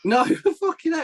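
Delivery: high, laughing protest; laughing denial. Real, not acted. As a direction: laugh it off in disbelief.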